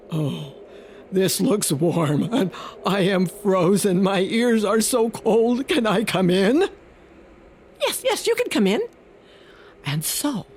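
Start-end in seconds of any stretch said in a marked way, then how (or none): 0.1-6.7 a frozen wolf asks to come in; big wolf. Acted. 7.8-8.8 very high, fast squeaky mouse; the mouse hurries to welcome the wolf; eager mouse. Acted.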